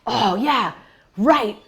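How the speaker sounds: the angry marcher backing down, still heated; sheepish agreement. Retold or imitated. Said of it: "And he was like".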